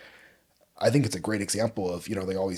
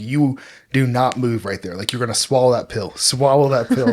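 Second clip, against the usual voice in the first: re-enacted stern dad, building louder; yelling, tough love; 3 semitones higher, 9 dB louder than usual. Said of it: "yelling at her to swallow the pill".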